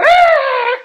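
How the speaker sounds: sudden high, loud held "Ah"; demonstrated cry. Shown as a demonstration. Preacher showing what crying out means, high held cry. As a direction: let out one sustained high cry. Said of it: "cry means he lifted up his voice".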